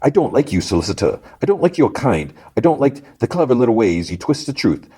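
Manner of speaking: high-pitched taunting villain voice; amused, menacing villain. Retold or imitated. Villain tells the lawyer he dislikes him, taunting and high. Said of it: "And he kinda laughs".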